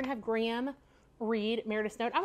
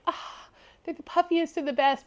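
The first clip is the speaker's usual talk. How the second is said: lightly imitated swooning heroine, higher pitch; Anne of Green Gables; 6 semitones higher than usual. Retelling Anne's delight at Matthew's puff-sleeve dress, lightly imitated gushing. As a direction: gush over it in a raised voice, half acting. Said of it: "reminded me of Anne of Green Gables".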